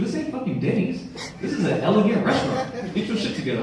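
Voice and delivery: high, hushed scolding with swooping pitch; scolding wife. Retold or imitated. Wife scolding her husband in a restaurant, acted indignant. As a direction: tell him off without raising your voice.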